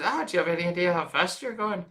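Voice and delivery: imitating an Irish-accented cop. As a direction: put on the actor's Irish brogue. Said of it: "an audition with an Irish accent".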